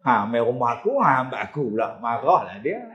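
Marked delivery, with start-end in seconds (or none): none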